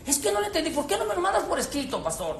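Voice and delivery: high, fast, whiny mock complaint; whiny mock confusion. Congregant whining to the pastor, high and fast. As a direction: whine like a listener dodging the point.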